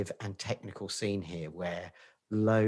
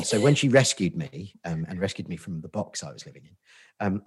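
ordinary delivery

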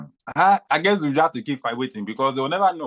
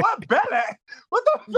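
higher mock disbelief; mock shock. Retelling his shocked reaction to a friend's word. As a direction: squeal it like you can't believe it. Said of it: "I'm like".

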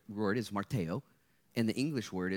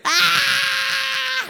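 loud, high whoop for the photo; selfie shout; 16 dB louder than usual. Real, not acted. Pastor whooping with the crowd for the selfie, loud high yell. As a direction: yell it out as the shutter clicks.

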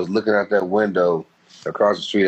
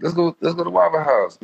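higher, eager imitation of a buddy; lightly voiced friend inviting; friend's invite; 8 semitones higher than usual. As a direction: urge him to come eat. Friend inviting to Waffle House.

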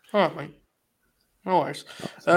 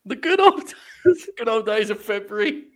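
laughing mock echo; 7 semitones higher, 3 dB louder than usual. Echoing a friend's nostalgia.

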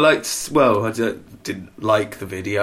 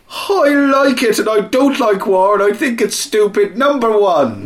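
mock Irish accent; 9 semitones higher, 5 dB louder than usual. Joking about Ireland's chart, in mock Irish accent. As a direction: chirp it like a cheerful Irish fan. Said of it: "In Ireland, they said".

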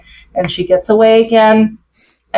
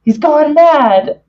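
high, slow, stretched-out warning; a touch of mock alarm; mock panicked claim; 7 semitones higher, much slower than usual. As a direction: drag it out like a spooked friend. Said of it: "Randy's like".

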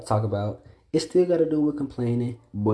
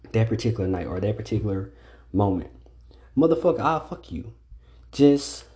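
normal talking voice

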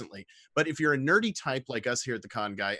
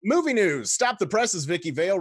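announcer headline; 6 semitones higher, 6 dB louder than usual. Acted.

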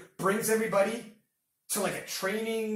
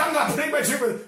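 mock stubborn refusal; 5 semitones higher, 5 dB louder, much faster than usual. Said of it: "He's like".

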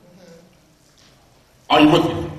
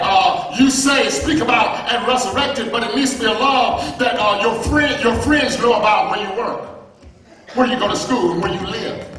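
full-force preaching; 11 semitones higher than usual. Acted. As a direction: lift the congregation, preaching hard.

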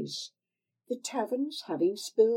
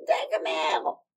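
put-on louder call of a name, old hag voice; crone's call; 5 dB louder than usual. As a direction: call the servant down. Old woman calls out.

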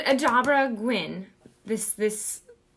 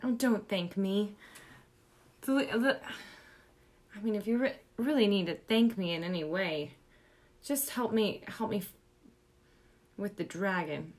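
soft, low, hesitant; subdued request; 4 semitones lower, 8 dB quieter than usual. Acted. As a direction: trail into a quiet, halting plea. Player quietly asks Galandro for help.

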